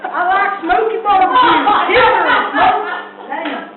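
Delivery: loud, slow Southern accent; Southern American accent. Shown as a demonstration. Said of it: "that is a good Southern accent".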